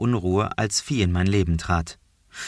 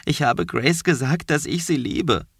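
higher, shaky confession; 7 semitones higher than usual. Acted. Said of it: "mit zittriger Stimme".

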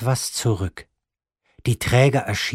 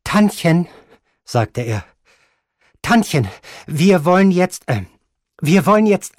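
higher, louder, faltering with hesitations; voice trembling and failing; 7 semitones higher, 5 dB louder than usual. Acted. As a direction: stumble as the nerve gives out. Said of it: "Seine Stimme zitterte und versagte".

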